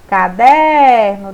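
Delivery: "caderno" with "der" hugely drawn out and loud; stress on middle syllable. Shown as a demonstration. Teacher demonstrating the tonic syllable, "caderno" stretched on "der". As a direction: lean long and hard on the "der" of "caderno". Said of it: "pronunciadas com mais intensidade".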